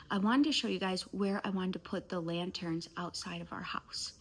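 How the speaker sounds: usual speaking voice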